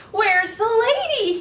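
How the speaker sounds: a lost pup calling out for her; worried dog voice. Acted. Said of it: "he wondered".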